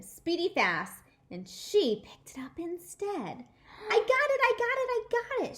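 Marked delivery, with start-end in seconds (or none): 3.9-5.6 very high, loud triumphant screech. Acted. May grabbing the egg, triumphant screech. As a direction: squeal it in triumph. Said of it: "she screeched".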